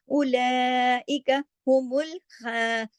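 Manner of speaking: slow, drawn-out Quran recitation; chanting the verse as a teacher's model; tajweed recitation. Shown as a demonstration. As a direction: recite it slowly with careful tajweed. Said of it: "खा मोटा है ना".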